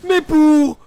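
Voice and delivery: high, loud hype play-by-play; esports caster. Acted. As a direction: scream the teamfight call live. Teamfight hype call.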